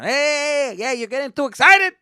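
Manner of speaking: loud, high-pitched mock dad rant; scolding dad. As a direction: shout down an overexcited kid. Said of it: "my dad would just bring me back down".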